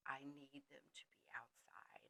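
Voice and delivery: confiding whisper. Real, not acted. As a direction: whisper it like a guilty secret. Confiding joke about kids.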